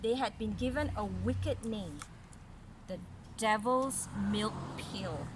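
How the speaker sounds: clear, even read-aloud story voice; story narration. A narrator's reading. Mother reading a story to little Caris, storytelling voice. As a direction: read it like a picture book to a child.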